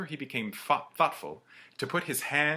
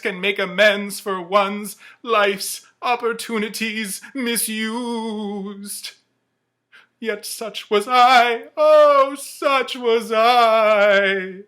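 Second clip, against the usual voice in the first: high, loud, slowing to a drawn-out wail; remorseful ghost; 10 semitones higher, 10 dB louder than usual. Acted. The ghost mourns his misused life, wailing high and slow. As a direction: lament your own wasted life.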